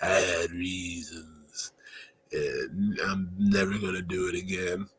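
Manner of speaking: a lightly voiced, curt dad, soft and low; imitating the terse dad. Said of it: "He's like".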